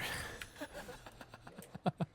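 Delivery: a sudden high airy burst of laughter; short breathy giggle; real high laugh. Real, not acted. Laughs at something on screen.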